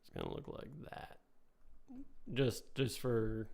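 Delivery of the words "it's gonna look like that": whispering. Real, not acted. Murmurs while drawing.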